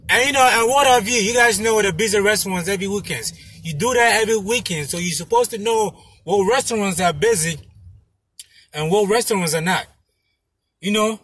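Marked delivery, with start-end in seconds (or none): none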